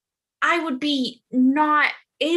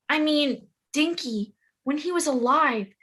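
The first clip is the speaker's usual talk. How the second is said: usual speaking voice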